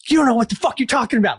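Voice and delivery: hammed-up angry teenager, rushed and high; angry teen retort. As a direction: fire it back like a furious seventeen-year-old. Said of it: "so fucking angry".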